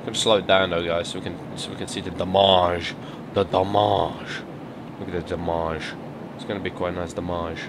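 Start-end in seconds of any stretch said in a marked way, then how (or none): 2.0-7.6 "damage" said as "dah-MAHZH"; mock-French pronunciation. Gamer inspecting the wrecked car, "damage" as mock-French "dah-MAHZH".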